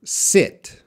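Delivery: short lax i in "sit". Shown as a demonstration. Teacher modelling "sit" for the class. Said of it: "this is pronounced".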